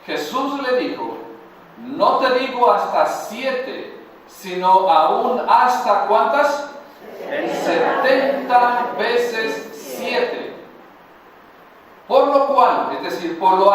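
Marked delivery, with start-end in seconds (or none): 1.8-10.6 slow emphatic quoting. Acted.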